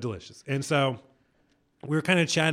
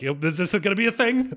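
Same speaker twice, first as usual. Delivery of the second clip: higher-pitched, lightly voiced comic impression; a hint of awkward questioning, pitched up; Larry David; 7 semitones higher than usual. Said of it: "my inner Larry David was like".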